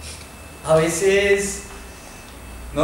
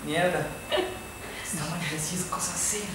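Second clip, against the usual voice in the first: muttered to himself; 11 dB quieter than usual. Acted. Dice para sí mismo.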